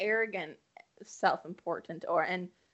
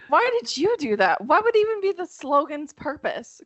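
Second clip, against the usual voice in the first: higher and louder, half-laughing disbelief; mock outrage; 8 semitones higher, 8 dB louder than usual. Real, not acted. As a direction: act scandalised, loud and high.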